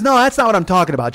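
loud, high imitation of mom; exasperated mother. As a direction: cut in loudly as an exasperated mom. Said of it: "and she goes".

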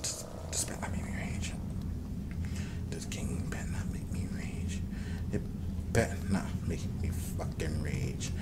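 very soft, voiceless whisper; seething whisper. Real, not acted. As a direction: whisper a warning under your breath. Muttered warning after a game setback.

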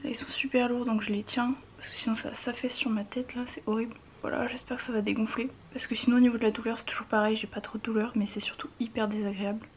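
soft whisper, holding ice packs. Real, not acted. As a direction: whisper it wearily.

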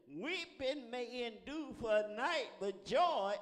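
slow, loud swell to a ringing peak; declaimed scripture. Real, not acted. Preacher quoting scripture to congregation, slow and building loud. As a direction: stretch it out and build to JOY.

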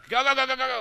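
high, loud rapid urging; loud, high chanting while rushing through the level; urgent cheer. Real, not acted. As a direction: chant it fast and loud to hurry. Urging himself again.

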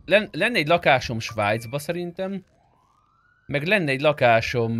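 ordinary delivery

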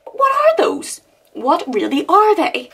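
put-on high, sing-song children's-show host; mock kids' TV voice. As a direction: talk down like a preschool presenter.

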